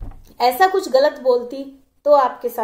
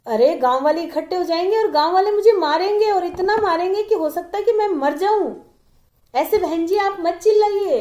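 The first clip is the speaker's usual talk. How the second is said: higher, louder frightened plea; trembling with fear; 6 semitones higher, 4 dB louder than usual. Acted. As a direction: beg in panic, voice pitched up. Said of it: "ऐसे धूजने लग गया".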